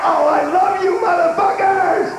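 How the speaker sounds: normal talking voice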